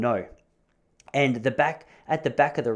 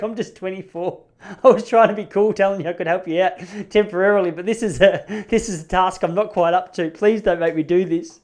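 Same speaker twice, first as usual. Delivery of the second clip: higher, louder comic backpedalling; mock pleading; 6 semitones higher, 5 dB louder than usual. Young dad backing out of selling marijuana, mock pleading. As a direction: beg off in a jokey panic.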